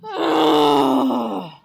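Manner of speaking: loud frustrated groan; exaggerated groan. Gerald fed up with waiting, loud groan. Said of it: "The word says groan".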